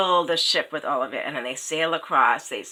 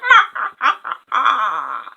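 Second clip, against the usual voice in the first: high cackling raven laugh; 7 semitones higher, 9 dB louder than usual. A narrator's reading. Raven laughs after the curse.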